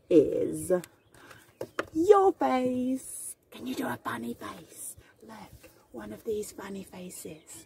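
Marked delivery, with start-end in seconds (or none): 3.5-7.6 whisper. Acted. Asks child softly.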